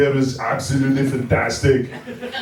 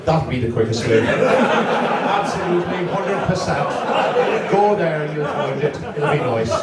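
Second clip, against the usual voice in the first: comic Irishman giving directions, put-on accent; stretched-out comic Irish accent; 3 semitones higher, slower than usual. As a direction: reassure them in a mock Irish lilt. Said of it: "this guy was Irish".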